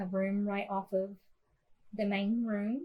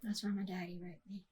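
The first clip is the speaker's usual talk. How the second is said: soft confession; 7 dB quieter than usual. Retold or imitated. Mother's quiet confession.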